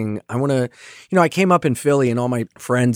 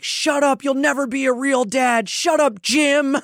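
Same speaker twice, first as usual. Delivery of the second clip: high, bratty shouted retort; shouting at stepdad Jim as a kid; kid yelling at stepdad; slower than usual. Retold or imitated. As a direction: snap at the stepdad like an angry kid. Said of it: "I would be like".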